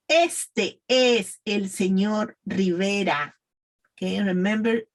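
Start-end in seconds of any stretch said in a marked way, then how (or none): 0.1-3.3 slow, evenly spaced model sentence; pronunciation practice. Shown as a demonstration. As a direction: say it slowly for learners to repeat. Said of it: "practice with me, please".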